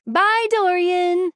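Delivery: high, drawn-out farewell call; cheery goodbye. Acted. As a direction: sing out the goodbye, slow and high. Calling goodbye to departing Dorian.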